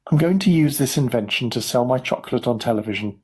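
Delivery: usual speaking voice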